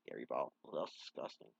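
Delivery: whispered in disgust. Real, not acted. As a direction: breathe it out, grossed out. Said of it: "I'm grossed out right now".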